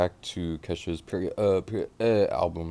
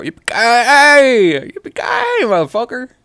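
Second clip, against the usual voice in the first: loud high country yell; whooping yippee ki yay; excited holler; 17 dB louder than usual. Real, not acted. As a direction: holler it like a cowboy.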